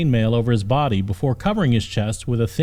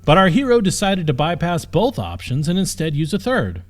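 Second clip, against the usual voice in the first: usual speaking voice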